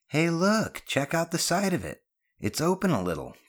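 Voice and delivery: high-pitched call of discovery; excited call-out. Acted. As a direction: alert your friends, starting high and easing down. Boy spots something odd, calls out.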